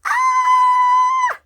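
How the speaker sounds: high, loud shriek of delight; excited scream. Real, not acted. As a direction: let out a thrilled fangirl scream. Unboxing a long-awaited collectible.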